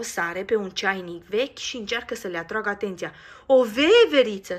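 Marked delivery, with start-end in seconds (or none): 3.5-4.6 very loud, high joyful cry; happy shout. Acted. Another child spots the squirrel, a loud joyful shout. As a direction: shout with delight. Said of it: "strigă bucuros".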